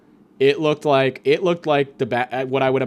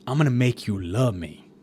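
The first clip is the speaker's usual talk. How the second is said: quoted Sopranos line; 2 semitones lower than usual. Acted. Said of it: "It's from the S-, The Sopranos".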